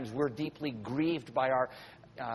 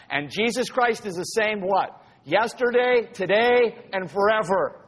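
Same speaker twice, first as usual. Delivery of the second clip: rising, louder preaching build; forceful preaching; 5 semitones higher, 5 dB louder, slower than usual. Acted. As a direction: hammer home each word to the congregation. Preacher drives home a point.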